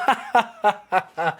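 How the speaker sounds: drawn-out imitated gloating laugh; mock laugh. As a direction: fake his laugh, one ha after another. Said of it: "he's laughing".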